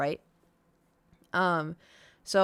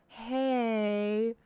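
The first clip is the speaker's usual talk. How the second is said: vet's knowing hey; 5 semitones higher than usual. Retold or imitated. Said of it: "I knew immediately, just the".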